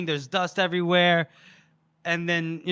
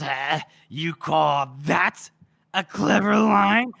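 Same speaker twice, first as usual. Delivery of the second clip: scornful retort; slower than usual. Retold or imitated. Stabbed man retorts to his killer, slow mock scorn. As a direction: drag it out as a mocking comeback.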